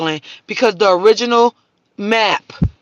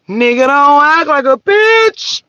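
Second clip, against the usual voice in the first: higher, louder, slow put-on preacher voice; Christ voice; 5 semitones higher, 6 dB louder, slower than usual. Christ warning his people, high, loud and slow. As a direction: deliver it like a stern sermon line. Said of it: "He was over there like".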